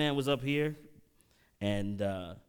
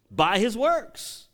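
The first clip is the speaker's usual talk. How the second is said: higher, louder and slow, hammer it; emphatic preaching; 8 semitones higher, 7 dB louder, much slower than usual. Real, not acted. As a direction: drive the point home. Preaching point hammered home.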